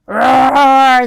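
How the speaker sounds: huge, loud roaring growl; bear roar. Acted. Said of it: "The old bear roared".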